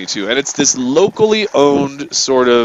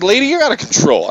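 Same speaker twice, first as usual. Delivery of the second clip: retold accusation; 9 semitones higher than usual. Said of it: "I'm like".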